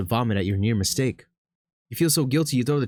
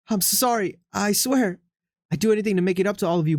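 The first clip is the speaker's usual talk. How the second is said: frantic begging; 9 semitones higher than usual. Acted. Begging the cats.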